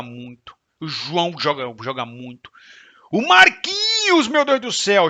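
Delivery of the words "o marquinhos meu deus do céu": excited. Real, not acted. Raving about a player's quality.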